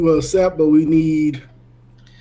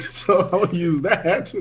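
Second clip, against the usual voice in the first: slowed by laughter as you speak; amused, laughing through the words; 2 semitones higher, slower than usual. Real, not acted. Amused at having the right skill.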